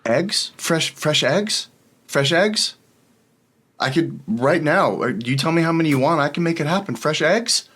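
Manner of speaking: high, rising farmer hawking eggs; eager farmer. Acted. Farmer with a chicken offering eggs to the adventurers, high and eager. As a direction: push your fresh eggs on them. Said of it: "and he says".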